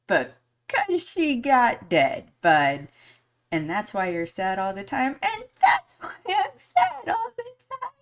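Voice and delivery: voice breaking into tears. Real, not acted. Mother answering her son about her dead sister, crying voice. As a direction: let the voice climb and break as you cry. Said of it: "made my voice go real high".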